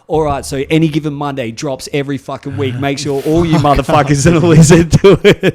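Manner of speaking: loud hype announcement, building to a shout; retold brash plug. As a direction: shout out your show to the whole call. Retells plugging his show on call.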